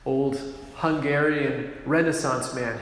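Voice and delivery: ordinary talk, nothing marked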